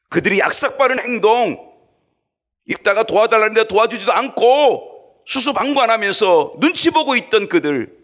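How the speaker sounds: loud, high preaching rebuke; fiery preaching. Acted. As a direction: thunder it at the congregation. Preaching at full force.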